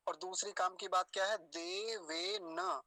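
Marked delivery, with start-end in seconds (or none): 1.4-2.8 देवेन very slowly as "de-ve-na"; देवेन with long e's and an open final na; final vowel sounded. Shown as a demonstration. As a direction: draw out देवेन, ending on a full a. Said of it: "हलंत नहीं है यहाँ पर".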